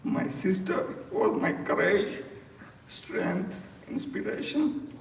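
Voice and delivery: real choked-up voice, pitched high; emotional. Real, not acted. Brother eulogizing his sister who protected him, overcome with emotion. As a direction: fight through tears, the pitch climbing. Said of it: "I'm going to be emotional".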